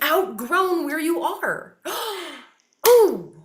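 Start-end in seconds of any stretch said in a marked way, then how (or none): 2.9-3.3 a gasp then a higher, louder ooh; dramatic gasp. Acted. Underlining her insight for listeners, a dramatic gasp. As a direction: gasp in mock awe.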